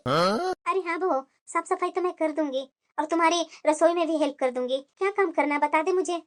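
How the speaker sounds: put-on an old woman quietly offering help; elderly mother-in-law obliging.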